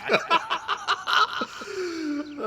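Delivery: long breathless giggle fit; high wheezing laugh. Real, not acted. Reader breaking down laughing at the text, wheezing. Cracks up.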